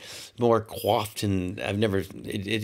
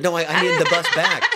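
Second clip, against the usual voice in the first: laughing insistence; 8 semitones higher, 6 dB louder than usual. Retold or imitated.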